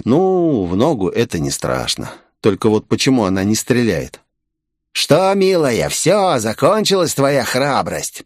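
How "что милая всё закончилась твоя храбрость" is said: high, put-on villain voice; creepy taunting bandit. A narrator's reading. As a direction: taunt her with sing-song menace. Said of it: "пролязгал жутковатого вида мужичок". (жутковатый голос бандита с издёвкой)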